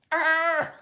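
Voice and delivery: mock frustrated growl. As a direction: let out a pent-up growl of frustration.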